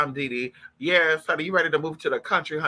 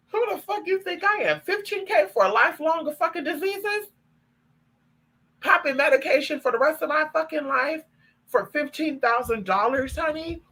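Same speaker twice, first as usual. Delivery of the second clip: outraged disbelief; 8 semitones higher than usual. Real, not acted. Outraged at a fifteen-K offer.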